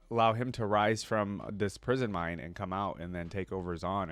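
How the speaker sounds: usual speaking voice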